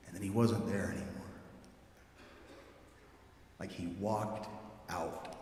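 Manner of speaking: quiet reverent awe; hushed awe. Real, not acted. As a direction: drop to a hush for the wonder of it. Awe at the empty tomb.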